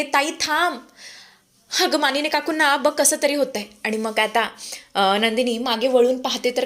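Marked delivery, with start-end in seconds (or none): 0.0-3.6 urgent shout. Acted. Stopping sister as aunt falls ill, acted urgent call. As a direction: call her back with mild urgency. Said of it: "ती आता जोरातच ओरडते".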